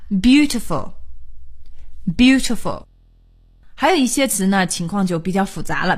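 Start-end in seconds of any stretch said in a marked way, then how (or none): 0.1-2.8 "beautiful" as BEAU-ti-ful, said twice; BEAU-ti-ful, weight up front; stress on first syllable. Shown as a demonstration. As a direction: model "beautiful" with a strong first syllable, pausing between repeats. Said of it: "重音呢就是在第一音节上".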